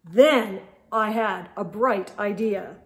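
put-on lively read-aloud for kids; animated storybook reading; story narration. A narrator's reading. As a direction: read it like a picture book.

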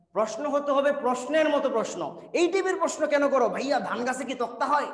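ordinary talk, nothing marked

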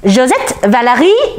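high-pitched summons; frantic little man calling out; shrill, urgent shout. A narrator's reading. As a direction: call both names urgently on a rising tune. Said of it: "the man shouted, shrill and urgent".

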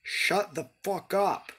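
slow, drawn-out furious order; snapping at narrator. Real, not acted. Player to the game narrator, slow furious order. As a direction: tell the narrator to shut up, furious.

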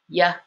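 YA as a short consonant-Y sound; isolated Y sound in YA; Y sound demo. Shown as a demonstration. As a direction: sound out YA for learners. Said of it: "So very different".

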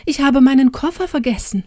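high, hurried confession of a mishap; dismayed and flustered; stammered dismay. A narrator's reading. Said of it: "stammelte sie".

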